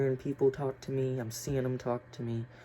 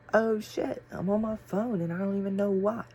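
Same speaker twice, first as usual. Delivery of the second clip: startled realization; 9 semitones higher than usual. Retold or imitated. Surprised finding phone in hand.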